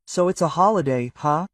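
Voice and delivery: plain reading voice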